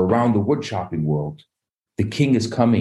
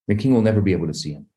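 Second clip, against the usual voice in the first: usual speaking voice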